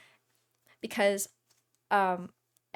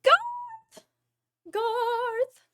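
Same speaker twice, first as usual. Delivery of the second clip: calling out; 5 dB louder than usual. Acted. Calling out to a friend.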